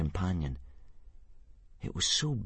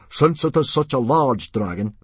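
loud, raised authoritative address; big public speaking voice; chief-like; 6 semitones higher, 7 dB louder than usual. A narrator's reading. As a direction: take command like a chief. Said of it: "in his most chief-like manner".

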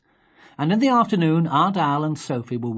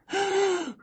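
high shocked intake of breath; mother gasping at the mouse in the box; sharp startled gasp. Acted. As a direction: gasp as if you just saw a mouse. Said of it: "She cried".

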